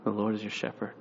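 grandma reassuring him, soft and tender; grandma's saying. Retold or imitated. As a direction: say it gently like grandma. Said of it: "would always say".

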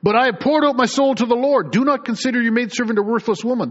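"poured" stressed and falling in a loud reading; fervent scripture reading. Acted. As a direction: cry it out as Hannah's plea. Preacher reads Hannah's plea.

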